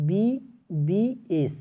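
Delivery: এমবিবিএস stretched slowly, starting on an eh vowel; teacher slowly sounding out এমবিবিএস; slow careful pronunciation. Shown as a demonstration. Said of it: "তো sound দেখো".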